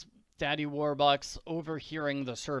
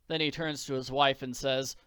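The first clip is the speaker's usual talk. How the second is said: ordinary delivery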